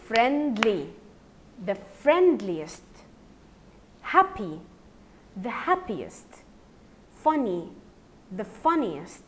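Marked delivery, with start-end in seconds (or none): none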